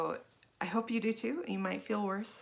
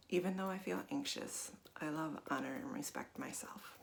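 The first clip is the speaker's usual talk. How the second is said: guided tapping script; 3 dB quieter than usual. Acted. Guide leading viewers through a tapping round, softly. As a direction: talk the listener through each tapping point gently.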